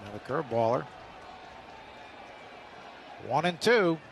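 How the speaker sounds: ordinary talk, nothing marked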